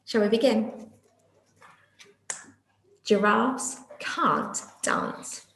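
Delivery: usual speaking voice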